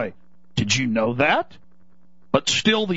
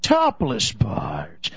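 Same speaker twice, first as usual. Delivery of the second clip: whisper; 8 semitones lower, much slower than usual. Pretend. Conspiratorial listing.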